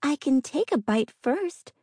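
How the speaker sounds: put-on high, timid young woman; eager nervous girl, pitched high. A narrator's reading. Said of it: "Inga tried again".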